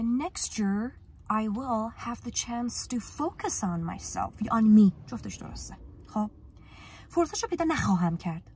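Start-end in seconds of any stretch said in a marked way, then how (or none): 0.0-4.3 slow model sentence. Shown as a demonstration. Teacher models full sentence. 4.6-4.9 loud correction. Shown as a demonstration. Teacher corrects, loud alternative.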